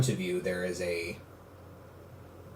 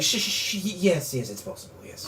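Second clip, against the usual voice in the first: soothing shushing; 8 semitones higher, 4 dB quieter than usual. Acted. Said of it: "And Noel's like".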